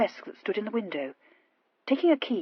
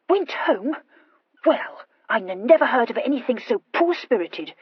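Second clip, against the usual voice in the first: stammered, indignant scorn; disgusted; 4 semitones higher, 5 dB louder than usual. A narrator's reading. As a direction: exclaim it in contemptuous disgust. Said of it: "exclaimed Horatia with disgust".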